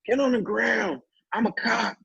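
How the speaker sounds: high-pitched imitation of a yelling cop; mock yelling. Retelling the cop's orders during the scuffle, high mock yelling. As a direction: yell it in a high, strained voice, without real volume. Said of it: "Now he's yelling".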